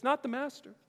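a hint of a whimpering creature; a mild high-pitched protest; Smeagol. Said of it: "Smeagol says".

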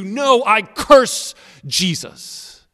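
slow, high, loud curse; most intense denial. Acted. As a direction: spit out the curse as the peak of a build-up. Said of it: "the progression and the intensity".

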